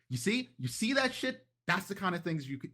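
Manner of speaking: indignant outburst. Acted. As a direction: rise up in outrage, calling out the insult. Dolce arguing with her other self.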